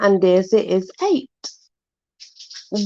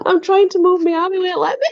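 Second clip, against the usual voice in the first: high exasperated complaint; 11 semitones higher, faster than usual. Real, not acted. Telling viewers the dog won't let go, high and exasperated. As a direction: squeak up, amused and fed up.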